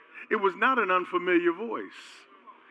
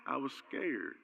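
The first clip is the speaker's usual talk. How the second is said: much softer, low sheepish admission; sheepish answer; 5 semitones lower, 14 dB quieter, slower than usual. Retold or imitated. Person explaining their fear, soft sheepish mumble. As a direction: mumble it like a caught child.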